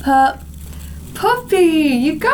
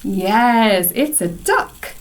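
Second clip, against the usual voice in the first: ordinary delivery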